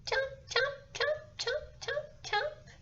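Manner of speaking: very high squeaky tiny chomps; snapping teeth. Acted. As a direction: squeak like a teeny alligator. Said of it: "tiny little alligator. It just goes".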